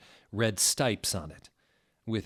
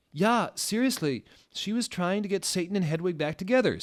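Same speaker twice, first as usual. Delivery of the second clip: high whiny agreement; 11 semitones higher, 4 dB louder than usual. Acted. Sirius chiming in high.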